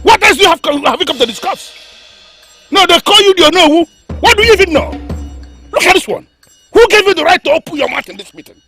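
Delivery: furious berating. Acted. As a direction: cut the advisor down. King berates the Onowu angrily.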